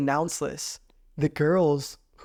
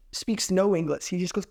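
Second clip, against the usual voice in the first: ordinary talk, nothing marked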